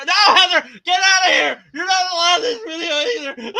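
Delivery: loud, high shout breaking into laughs; laughing shout. Pretend. Host ejecting Heather from his video, laughing shout. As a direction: throw her out while cracking up.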